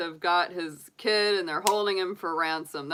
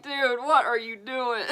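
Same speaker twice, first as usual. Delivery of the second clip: laughing disbelief; 6 semitones higher, 3 dB louder than usual. Retold or imitated. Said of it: "I'm like".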